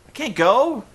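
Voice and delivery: squeaky high protest; lightly mocking the Pope; high mock whine. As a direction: Pope refusing to leave, high whiny. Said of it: "Pope's like".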